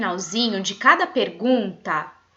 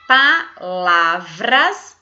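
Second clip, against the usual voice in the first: slow dictation word; 4 semitones lower, 6 dB louder, much slower than usual. Shown as a demonstration. Teacher reading the missing syllables: slow loud dictation. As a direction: draw out palavras slowly and louder. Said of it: "Então eu vou ler".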